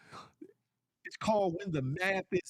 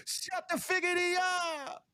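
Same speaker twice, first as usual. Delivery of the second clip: high, slow put-on voice; sing-song mock scold; drawn-out high silly voice; playful catchphrase; 10 semitones higher than usual. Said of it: "Or as I like to say".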